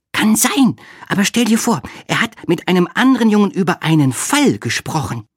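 high-pitched character voice sharing news; Olga, eager to tell. A narrator's reading. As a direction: pass on gossip in a high voice.

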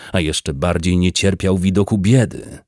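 ordinary delivery

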